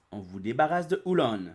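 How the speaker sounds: ordinary talk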